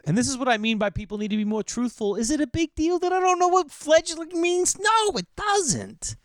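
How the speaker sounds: very high, strained squeal of protest; hammed-up high-pitched indignation; mock outburst. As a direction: rant up into a squeaky pitch, stretching the final words. Mock outburst over not knowing fledgling.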